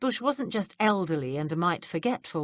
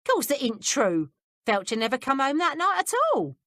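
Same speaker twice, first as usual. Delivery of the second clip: servant woman voice; 8 semitones higher, 3 dB louder than usual. A narrator's reading. Mrs Blodgett, Cockney servant voice.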